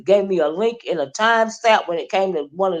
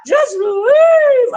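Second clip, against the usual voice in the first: high, loud mock yell of leave; shrieking imitation, much higher and louder; hollering woman impression; 5 dB louder than usual. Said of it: "she was over there hollering".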